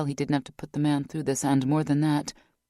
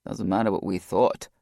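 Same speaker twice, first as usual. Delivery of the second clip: deep, sad male voice; lower, flat with guilt; Dunn, low and grim; 7 semitones lower than usual. A narrator's reading.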